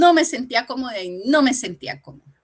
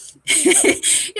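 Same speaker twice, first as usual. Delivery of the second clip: short unvoiced laugh; breathy laugh; 11 semitones higher, 5 dB louder than usual. Real, not acted. Woman laughing while retelling the pastor's words, airy laugh. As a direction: let a quick airy laugh out. Speaker laughs after quote.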